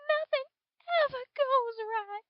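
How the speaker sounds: high, furious outburst; cried out. A narrator's reading. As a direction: fling it out in angry despair. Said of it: "she cried out".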